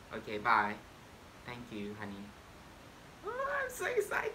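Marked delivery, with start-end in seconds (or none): 3.3-4.3 squealy excitement. Acted.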